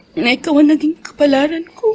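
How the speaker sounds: rising, tearful gratitude; voice breaking. Acted. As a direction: let the voice crack with emotion. Wife moved close to tears. (nangingiyak na pasasalamat sa asawa)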